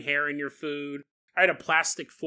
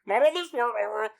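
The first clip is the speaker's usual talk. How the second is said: high, loud squeaky ham voice; the ham begging, hammed-up; 6 semitones higher, 5 dB louder than usual. Ham steak pleading not to be torn, high cartoon voice.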